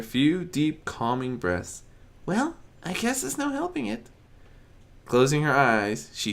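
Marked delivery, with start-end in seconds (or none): 2.3-4.1 high, soft, resigned; resigned Fluttershy. Acted. Fluttershy resolving to move on, soft and resigned. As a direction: sigh and accept it.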